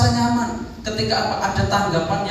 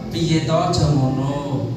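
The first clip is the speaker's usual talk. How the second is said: gentle Javanese speaker; 7 semitones lower, slower than usual. Retold or imitated. Preacher imitating how Javanese people phrase things, gently. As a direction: ease it out like a polite Javanese speaker. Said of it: "Kalau orang Jawa".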